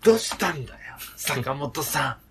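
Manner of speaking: playful comic impression; Otake impression. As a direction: ham it up like Otake. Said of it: "大竹かよ".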